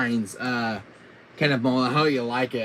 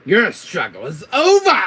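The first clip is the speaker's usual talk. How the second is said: triumphant villain shout; loud, high, hammed-up villain cry; Wesker; 9 semitones higher, 13 dB louder than usual. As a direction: roar it in triumph. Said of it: "my Wesker voice".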